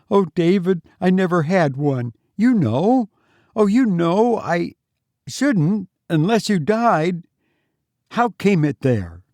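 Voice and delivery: high, anxious woman's voice; distressed wife. A narrator's reading.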